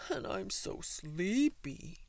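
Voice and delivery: slow, drawn-out sleepy elephant. A narrator's reading. Elephant kept awake by the bird, sleepy drawl. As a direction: drag it out like a tired elephant.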